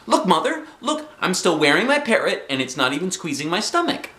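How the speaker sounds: high, excited call; shouted. A narrator's reading. Girl in water to Mother, shouting. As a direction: call out gleefully for attention. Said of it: "I shouted".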